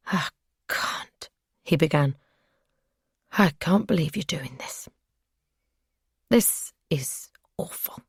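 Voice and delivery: unvoiced, aghast whisper; appalled captor, whispered; shocked captor whisper. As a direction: breathe it out in disbelief.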